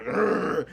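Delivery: imitated loud chainsaw growl; chainsaw noise. Michael Myers person with chainsaw, imitated revving growl. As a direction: rev it like a chainsaw, grr. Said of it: "takes the chainsaw and goes".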